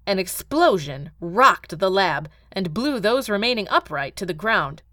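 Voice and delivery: ordinary delivery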